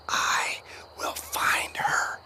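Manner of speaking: slow breathless whisper, no voice. Acted. Boy vowing to find the toddler, whispered. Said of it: "He whispered it".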